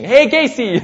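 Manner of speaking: high, loud shout of welcome; calling out. Retold or imitated. Congregation turning to greet Casey, loud high call. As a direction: call out across the room to a friend.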